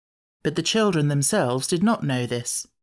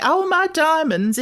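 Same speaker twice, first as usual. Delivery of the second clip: mock fainting lady; 13 semitones higher, 5 dB louder than usual. Martha imitating fainting lady.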